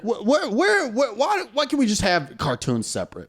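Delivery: high, stammering mock bewilderment; baffled protest. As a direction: stumble into a high-pitched, baffled complaint. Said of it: "You're like".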